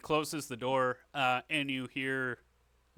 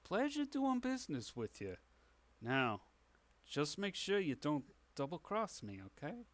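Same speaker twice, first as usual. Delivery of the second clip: genial crook with a veiled threat; sly underworld businessman; shady dealmaker; 2 semitones higher than usual. Acted. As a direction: warn them politely like a mob boss. Dealmaker character gives veiled threat.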